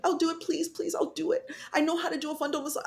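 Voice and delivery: high, fast, desperate begging; pleading. Retold or imitated. Patient begging nurses, high and fast. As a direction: beg to do it yourself.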